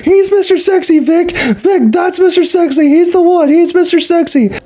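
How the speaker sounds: high, eager yelling of fans pointing someone out; excited fan yelling. Retold or imitated. Fans pointing, calling out to Vic.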